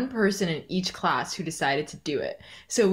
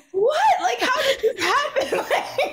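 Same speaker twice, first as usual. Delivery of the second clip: a squeal of laughing disbelief. Real, not acted. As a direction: burst out laughing in shock.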